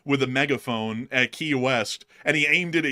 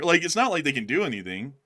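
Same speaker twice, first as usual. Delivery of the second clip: ordinary talk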